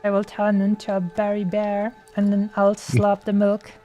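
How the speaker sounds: ordinary delivery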